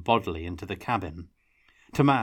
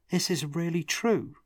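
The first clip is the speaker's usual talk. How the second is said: amazed; 10 semitones higher than usual. A narrator's reading. Tomas stunned by revelation.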